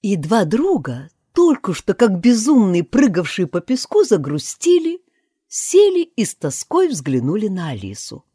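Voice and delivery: usual speaking voice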